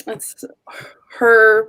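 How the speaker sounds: usual speaking voice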